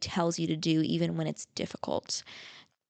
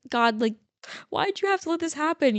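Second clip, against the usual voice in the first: higher, whiny plea to God; upset plea; 8 semitones higher than usual. Retold or imitated. Upset driver questioning God after the crash. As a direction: complain upward, hurt and put out. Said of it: "I was a little bit upset".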